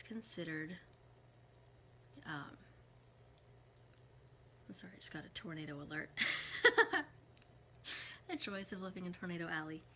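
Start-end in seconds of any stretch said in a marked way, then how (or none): 6.1-7.6 loud, high burst of laughter; real, sudden high laugh. Real, not acted. Reacting to a tornado alert.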